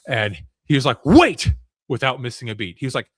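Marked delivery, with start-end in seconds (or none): 1.0-1.5 high, loud sudden cry of wait. Retold or imitated. Kid player interrupts the demon, loud high cry. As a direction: shout it out fast, cutting the demon off.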